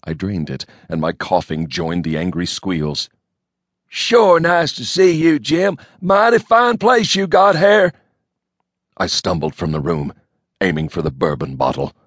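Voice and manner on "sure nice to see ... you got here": high, loud country bumpkin call; hillbilly Elmo voice. A narrator's reading. Elmo hollering at the pig in a high country twang.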